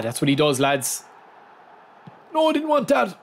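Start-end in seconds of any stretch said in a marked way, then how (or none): 2.3-3.2 high protesting cry at the game; frustrated yelp. Real, not acted. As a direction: squeal in protest at the controls. Game does wrong move.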